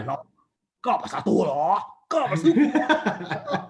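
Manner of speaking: slow, exaggerated dubbed-film voice; mock movie dub. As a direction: play a badly dubbed kung fu movie. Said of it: "they would do the dub English".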